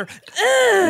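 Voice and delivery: high loud pained scream; pained shriek. Acted. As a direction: shriek as if burned. Hit by holy water.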